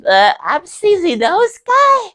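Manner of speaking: slow, very high, loud character voice; high-pitched silly character cry; Sneezy Nose Guy. Acted. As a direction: squeal the catchphrase, drawn out. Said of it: "a visit from Sneezy Nose Guy right now".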